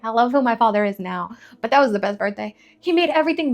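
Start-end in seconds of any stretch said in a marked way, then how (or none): none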